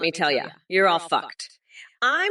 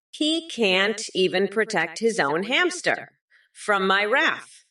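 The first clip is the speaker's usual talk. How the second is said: ordinary talk, nothing marked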